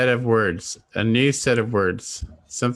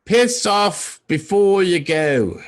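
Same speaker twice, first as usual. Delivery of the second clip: English accent; 6 semitones higher, 3 dB louder, much slower than usual. Shown as a demonstration.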